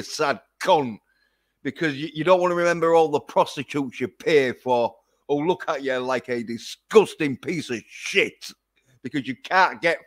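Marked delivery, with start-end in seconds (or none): none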